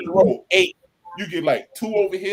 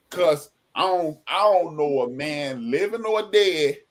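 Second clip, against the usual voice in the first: ordinary talk, nothing marked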